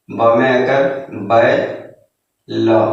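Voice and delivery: slow, careful sounding out; syllable by syllable. Shown as a demonstration. Teacher slowly sounds out बै for pupils. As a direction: spell it out slowly for a child. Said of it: "तोड़ के पढ़ना है".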